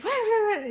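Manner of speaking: high imitated garbled speech; high-pitched gibberish impression of a customer; mock English gibberish. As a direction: mimic unintelligible English. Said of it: "la señora me volvía a hacer".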